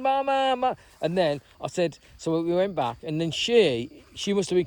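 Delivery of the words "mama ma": high, wavering call for the dog; retold tearful child; imitating a crying boy. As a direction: cry out mama like a little kid. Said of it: "he's going".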